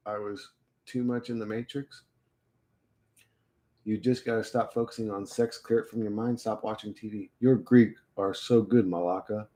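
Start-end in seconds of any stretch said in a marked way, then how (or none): none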